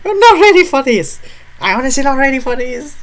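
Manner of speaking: high, loud panicked shriek; shrieking that you are not ready; terrified scream. Real, not acted. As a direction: scream it in real dread. Screaming about opening the door.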